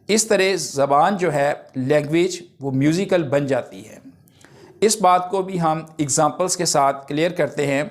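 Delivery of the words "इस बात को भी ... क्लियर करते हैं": ordinary talk, nothing marked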